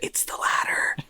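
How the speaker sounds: hushed secret whisper. Acted. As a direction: breathe it like a secret. Conspiratorial aside about witches.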